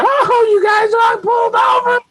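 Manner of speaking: very high mock exclamation; high, put-on voice of relatives; mimicked family. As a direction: squeal it, imitating excited relatives. Voices the family greeting them.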